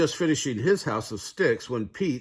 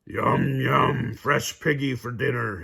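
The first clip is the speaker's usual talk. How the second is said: louder, hungry wolf voice; gleeful wolf; 3 semitones higher, 4 dB louder than usual. A narrator's reading. Wolf gloating over the pigs. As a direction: savour the meal to come. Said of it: "he cried".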